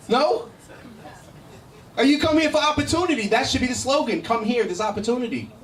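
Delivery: ordinary talk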